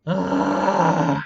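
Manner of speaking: a sharp, loud groan of disgust; loud frustrated groan. Real, not acted. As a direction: let out an exasperated ugh. Frustrated after failing.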